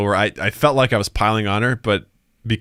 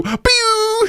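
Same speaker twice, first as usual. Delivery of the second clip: high-pitched sound-effect "pew"; mimicked slip of speech as noise; imitated pew noise. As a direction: squeak out a quick laser "pew". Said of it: "I'm gonna repeat that noise".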